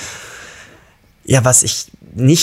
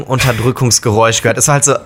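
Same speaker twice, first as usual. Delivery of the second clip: ordinary talk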